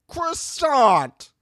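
high drawn-out mocking "Croissant"; mock put-down. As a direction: sneer her name down. Mocking cast member.

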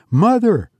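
put-on high, excited call of a child; a small boy waking his mother; eager boy. Johnny waking his sleeping mother, high and eager.

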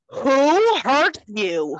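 acted feral fury. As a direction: draw out each word in a loud, feral demand. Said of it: "he gets all feral".

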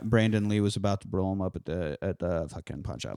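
normal talking voice

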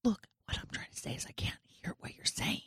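soft whispered explanation. Acted. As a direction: whisper back to explain yourself. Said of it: "We are all whispering".

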